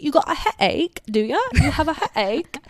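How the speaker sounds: high, teasing British kid imitation; mock British accent. Kid down the road making fun of her headache, mock British. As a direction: mimic a taunting kid's British accent. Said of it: "in a British voice 'cause a kid down the road would make fun of me".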